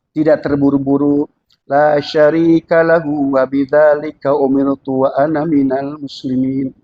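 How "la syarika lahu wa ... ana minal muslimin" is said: slow, low, unhurried opening prayer; calm recitation. Shown as a demonstration. Preacher demonstrates calm ibtitah to congregation, slow and low. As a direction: recite the ibtitah calmly, no rush.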